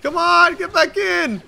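real frantic yelling, very high and loud; man down shout. Real, not acted. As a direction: yell in panic as your gunner goes down. Teammate down, player yelling.

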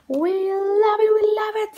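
put-on high, eager children's cheer; excited kids. Children begging the babysitter, high and eager. Kids beg for more rhymes.

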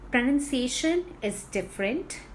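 normal talking voice

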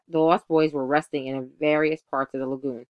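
ordinary delivery, nothing marked

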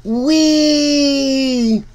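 ordinary delivery, nothing marked

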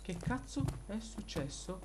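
ordinary delivery

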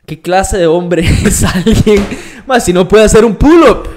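taunting challenge, half laughing; mock macho challenge. Shown as a demonstration. Host explaining his style to the interviewer, loud laughing taunt. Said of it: "por eso yo hablo así".

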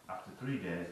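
usual speaking voice

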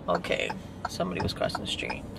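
fast whispered aside; hushed quick whisper. Real, not acted.

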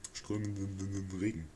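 soft, slow brooding echo; quiet muttered echo. Shown as a demonstration. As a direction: repeat "Strömenden Regen" softly and slowly, as if tasting a bad word. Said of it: "Scheiß Wort".

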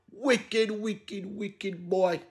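hushed, deliberate reproach; quiet condemnation. Real, not acted. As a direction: drop right down into a disapproving aside. Muttered condemnation after the outburst.

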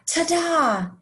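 high, sung-out ta-da; proudly unveiling an invention; triumphant reveal. Acted. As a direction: present it with a storybook flourish. Inventor unveils her wings in story.

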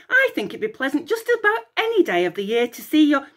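lightly voiced cheerful chatter; a touch of breezy babble; bright. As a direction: keep prattling brightly. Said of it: "Echo replied brightly".

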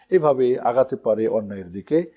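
normal talking voice

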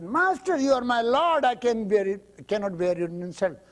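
high, loud, earnest protest; pleading disciple. Acted. Ananda protesting to Buddha, high and loud. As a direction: cry out your loyalty to the master.

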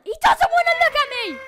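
high, loud complaint; indignant shout. Real, not acted. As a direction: yell it in offended disbelief. Enemy turns its back.

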